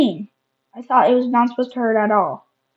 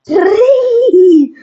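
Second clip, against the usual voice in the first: evil laugh; 11 semitones higher, 9 dB louder than usual. Pretend. Evil laugh after hit.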